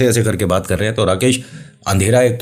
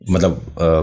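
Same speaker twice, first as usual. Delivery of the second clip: ordinary delivery, nothing marked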